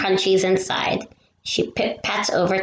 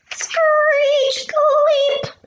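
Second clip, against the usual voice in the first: very high, louder squealing screech; tire screech; 3 dB louder than usual. Acted. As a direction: squeal it like tires braking hard. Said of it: "her tires make a loud screech".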